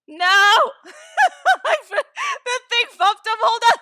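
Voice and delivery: loud, high shriek breaking into laughter. Real, not acted. Streamer hits the wrong button, shrieking with laughter. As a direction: cry out through real helpless laughter.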